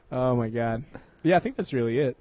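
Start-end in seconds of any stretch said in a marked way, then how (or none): none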